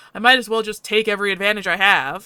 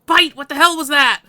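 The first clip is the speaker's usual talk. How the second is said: louder, higher angry demand; 6 semitones higher, 7 dB louder than usual. Acted. To Bite after the painful shock, an angry outburst. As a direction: round on Bite for the pain.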